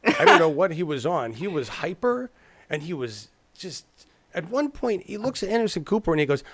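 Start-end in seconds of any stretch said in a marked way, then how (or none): none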